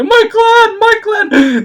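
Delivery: high, loud hammed-up wail; mock the fake gamer's despair. Mocking the TV gamer's reaction: high hammed-up wail. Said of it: "That was totally fake".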